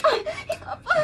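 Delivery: high, laughing cry; half laugh, half sob, lost it; high hysterical laughing outburst. Acted. Karen's laughing, crying outburst.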